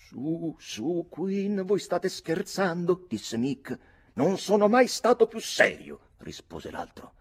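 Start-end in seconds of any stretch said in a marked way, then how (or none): 0.1-3.1 high-pitched, placating protest; raised voice, drawn out at the end; incredulous coaxing. A narrator's reading. Mick coaxes Quinn, disbelieving. 4.1-6.0 high, loud insistence; emphatic retort. A narrator's reading. Quinn firmly contradicts Mick.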